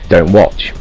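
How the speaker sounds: usual speaking voice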